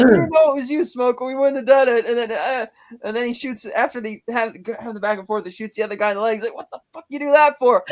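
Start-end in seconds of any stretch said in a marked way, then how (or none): 0.0-2.1 high, loud panicky plea; mock-scared goon voice; pleading henchman. Shot henchmen pleading with Smoke. 6.6-7.8 high, loud mock complaint; indignant retold shout; outraged yell. Shot man yelling at Smoke.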